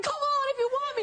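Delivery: high, hammed-up taunt; exaggerated high-pitched impression of an actress; mock film heroine. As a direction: parody a heroine calling out in a high voice. Host impersonates actress in film.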